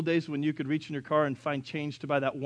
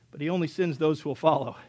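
ordinary talk, nothing marked

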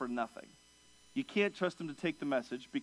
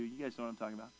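usual speaking voice

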